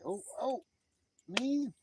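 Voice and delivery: high-pitched mock oohing; mock excitement. Quoting John about a shooting star, mock excited. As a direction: squeal it high, playfully. Acting out John's chat message.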